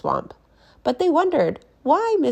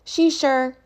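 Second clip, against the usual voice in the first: put-on higher, louder kids' chorus; eager kids; 8 semitones higher, 6 dB louder than usual. Kids cover for the teacher, loud and eager. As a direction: rush to answer for her. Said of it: "said the kids".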